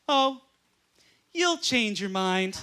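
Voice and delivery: slow, condescending mock reassurance; knowing, talking-down delivery; patronizing. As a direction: imitate a smug friend, drawn out slowly. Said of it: "the most patronizing thing ever".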